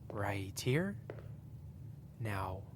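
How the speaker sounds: whispered, close and coaxing; soft whisper. Acted. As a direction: lean in and whisper it. Guiding listener to mirror closely.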